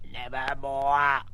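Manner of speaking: very slow croaking raven; loving croak. Acted. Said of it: "a loving croak in her ear said".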